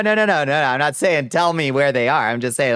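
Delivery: ordinary talk, nothing marked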